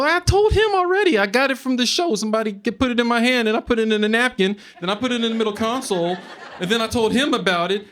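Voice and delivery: high-pitched, pleading protest to the cops; frantic high whine at the roadside cops. Retold or imitated. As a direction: whine it up high like a man begging to go home.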